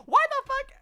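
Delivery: high-pitched disbelieving shriek; shocked outburst. Real, not acted. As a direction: squeal out in disbelief.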